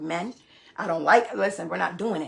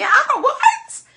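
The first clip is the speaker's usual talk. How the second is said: disbelieving laugh; 9 dB louder, much slower than usual. Real, not acted.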